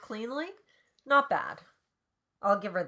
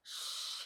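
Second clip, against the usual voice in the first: soft, airy "shh"; whispered shush; 11 dB quieter than usual. A narrator's reading. Calvin silencing Annabelle, whispered shush. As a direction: hush her gently with a whispered shh. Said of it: "he whispers".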